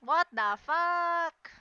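loud, slow, drawn-out shock; shocked outburst. Real, not acted. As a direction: stretch it out, stunned and loud. Sudden shock during the fight.

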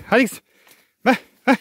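high loud herding call. Real, not acted. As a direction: shout to the cow. Calling the cow along.

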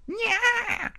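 high squealed nya; long, high cartoon yowl; mock creature cry.

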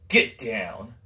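a hint of a stern mother's low warning; death stare command. Mother staring down her misbehaving kid, stern low warning. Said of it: "that death stare".

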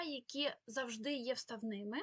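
normal talking voice

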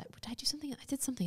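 unvoiced whisper to herself; whispered aside. Real, not acted. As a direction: whisper the question to yourself. Muttering to herself.

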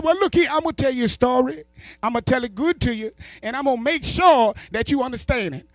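high put-on old uncle voice; the stoned uncle, high and sure of himself; uncle's voice. Uncle voiced telling a story.

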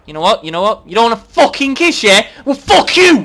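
building from loud to high yelling; mock-offended outburst. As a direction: blow up over being rejected. Rejected kiss, blows up.

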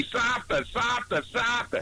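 high joking Boston vowels; Boston accent. Acted. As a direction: echo a Boston accent, dropping the R's. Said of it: "Boston accent kinda rubs me".

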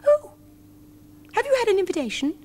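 high, fast eager squeak; excited mice. A narrator's reading. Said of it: "cried all the mice at once".

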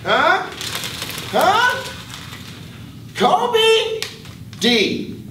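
loud, high call across the room; teacher calling a distracted student. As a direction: yell the name to get his attention.

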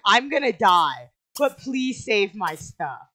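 imitating a fire victim, loud start, drawn-out words; slow, hammed-up begging; mock dying plea. Player mimicking the game character in the fire, mock pleading. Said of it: "were you just like".

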